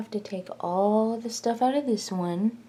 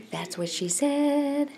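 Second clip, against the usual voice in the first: higher, slower put-on voice for the punchline; mock joke voice; 7 semitones higher, slower than usual. Joking to the camera about the fat jar in a higher put-on voice. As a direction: deliver the old joke in a silly higher voice.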